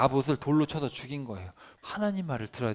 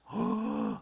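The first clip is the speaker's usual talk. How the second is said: shocked gasp. Acted. Reacts to the curse.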